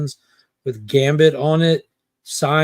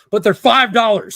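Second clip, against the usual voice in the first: mock indignant; 7 semitones higher, 6 dB louder than usual. Vendor insisting on price, mocking.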